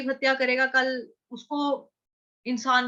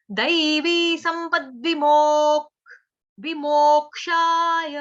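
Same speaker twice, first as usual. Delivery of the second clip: louder, slow, half-consonant stress marked; slow stressed recitation; 3 semitones higher, 6 dB louder, much slower than usual. Shown as a demonstration. Teacher demonstrating stress in the verse, slow and loud. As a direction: chant with the stresses clear. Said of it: "आघात कहाँ कहाँ पे आएगा".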